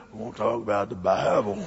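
put-on very low, quiet old-time sermon opening; imitated preacher's low rumble; grumbling preacher. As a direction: grumble it barely intelligibly. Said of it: "he kinda grumbles".

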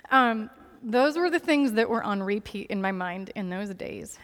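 normal talking voice

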